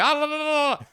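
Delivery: high loud mock yelling. Migrant workers yelling while beating the pimp: loud high mock yells. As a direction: imitate men shouting as they swing.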